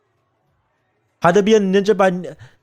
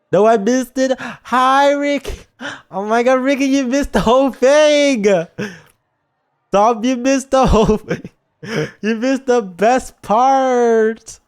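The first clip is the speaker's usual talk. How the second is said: loud, high cries of dismay at missing it; excited dismay; 6 semitones higher, 3 dB louder than usual. Real, not acted. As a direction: wail that you missed the whole thing. Reacting loudly to missed scene.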